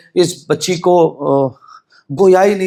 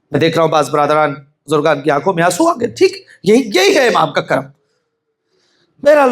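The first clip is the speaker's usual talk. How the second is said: usual speaking voice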